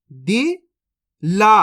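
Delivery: दिला stretched very slowly, each syllable held; slow teaching demo; drawn out slowly. Shown as a demonstration. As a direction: sound out दिला slowly for the learner. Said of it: "अब चलिए आगे देखिए".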